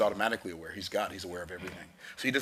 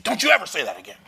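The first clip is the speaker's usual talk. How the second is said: loud, sharp scolding from a mom; angry mom; 5 semitones higher, 8 dB louder, faster than usual. Retold or imitated. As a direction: scold the kid, louder. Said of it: "She said".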